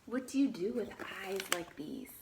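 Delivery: lively read-aloud, animal names lifted; reading to children. Acted. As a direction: read the book to the class, naming each animal brightly. Teacher reads picture book aloud.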